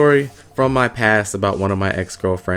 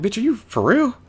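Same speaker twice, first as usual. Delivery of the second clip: disbelief; 12 semitones higher than usual. Retold or imitated. Confronting drunk girlfriend.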